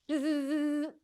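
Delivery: sustained electric buzz; imitated buzzer. Comic as chip alert going off, buzzing. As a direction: mimic a device buzzing.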